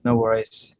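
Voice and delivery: ordinary talk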